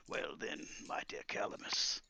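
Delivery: soft, breathy menace; hushed old master savouring a threat; old wizard, quietly sinister. Acted. Wizard replying to Calamus, quietly sinister.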